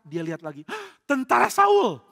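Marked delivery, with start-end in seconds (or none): 0.9-2.0 high, loud shout after a gasp; alarmed cry. Acted. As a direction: raise the alarm, acted big. Lookout gasps, spots enemy army.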